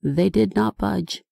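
usual speaking voice

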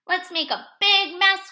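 high, loud, gleeful shout; excited cry. A narrator's reading. Bear urges Little Bird into mischief, excited cry. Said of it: "cried Bear".

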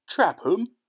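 high, loud, incredulous echo; disgusted cry. A narrator's reading. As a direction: throw it back in disgust, pitch shooting up. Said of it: "he cried, disgusted".

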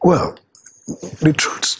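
ordinary talk, nothing marked

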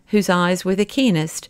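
usual speaking voice

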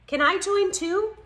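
eager newcomer. A narrator's reading. Newcomer asking to join friends at lunch, high-voiced. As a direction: pitch it up high and call it out.

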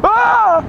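high held scream; scared high-pitched "Ah"; real frightened scream. Real, not acted. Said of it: "for screaming".

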